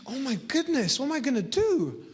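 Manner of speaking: a touch of storybook panic; mild alarm. Acted. Boy alone on the mountain as it gets dark, mild alarm.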